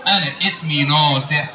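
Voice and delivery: slow, very low puppet voice; Aragoz puppet. Acted. Puppet telling the host his name, slow Aragoz voice. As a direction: draw it out as a puppet character. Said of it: "الأراجوز ده وهو بيرغي".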